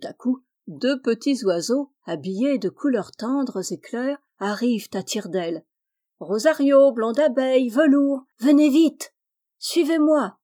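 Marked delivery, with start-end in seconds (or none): 6.2-10.4 high, loud urgent bird call; shouting. A narrator's reading. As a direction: call them to hurry. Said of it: "crie Plume". (Plume crie à ses amis de venir vite, aigu et fort)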